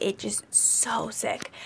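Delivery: slow whispered words; whispered misery. Real, not acted. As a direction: drag it out in a weak whisper. Describing being very sick.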